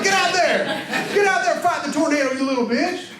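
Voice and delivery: high hammed-up yell at a kid; mock parent yell. Parent yelling at kid to fight tornado, mock yell. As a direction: yell him out the door.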